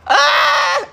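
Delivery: high, loud shriek of mortification; horrified shriek. Real, not acted. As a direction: shriek out the shame. Horrified at walking out exposed.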